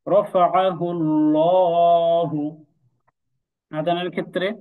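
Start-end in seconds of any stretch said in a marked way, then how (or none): 0.1-2.6 রফাআহুল্লাহু recited slowly with a thick, rounded lam; heavy lam recitation. Shown as a demonstration. Teacher gives the next example, slow Quranic recitation. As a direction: draw out রফাআহুল্লাহু, lam dark and full. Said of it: "এরপর".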